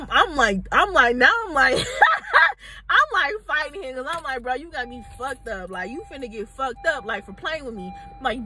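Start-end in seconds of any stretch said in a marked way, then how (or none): 4.4-7.9 much softer, dangerous warning. Retold or imitated. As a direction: threaten him quietly. Threatening him while fighting.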